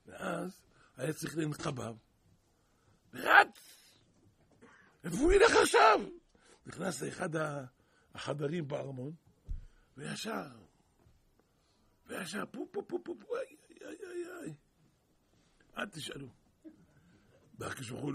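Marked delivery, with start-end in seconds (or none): 3.1-6.0 loud, high-pitched outburst; frantic storytelling. A narrator's reading. Preacher tells of Pharaoh running for relief, frantic high cry. Acts out Pharaoh's panicked dash. 12.5-14.5 high, soft puffs turning into wails; puff, then whimper high in mock pain; mock agonised wailing. Preacher imitates Pharaoh relieving himself, mock pained wails.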